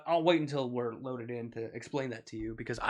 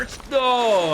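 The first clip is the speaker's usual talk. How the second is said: louder, high protest; frustrated shout; 10 semitones higher, 10 dB louder than usual. Real, not acted. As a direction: cry out in protest. Game frustration continues.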